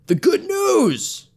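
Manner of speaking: high, slow, far-carrying shout; slow high shout of the good news. A narrator's reading. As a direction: call it out across the distance. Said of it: "he shouted".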